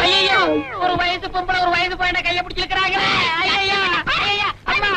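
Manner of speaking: theatrical panicked lament; mock cry for help. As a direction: cry out to passers-by in fake alarm. Cries for help as he is dragged.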